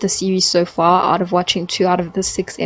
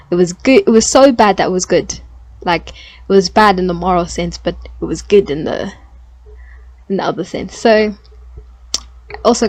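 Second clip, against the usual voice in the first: normal talking voice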